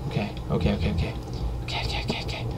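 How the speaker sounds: hushed string of okays, fading to a whisper; nervous whisper. Real, not acted. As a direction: talk yourself into it under your breath. Nervously psyching himself up.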